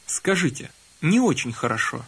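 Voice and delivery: ordinary talk, nothing marked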